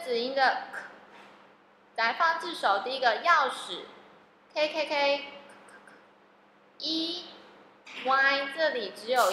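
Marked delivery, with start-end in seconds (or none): none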